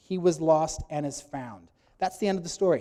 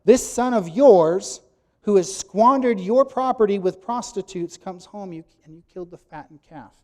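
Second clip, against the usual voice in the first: put-on older son accusing his brother to their father; bitter accusation; 2 semitones higher, 9 dB louder than usual. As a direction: hurl the charge at the father. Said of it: "here we go".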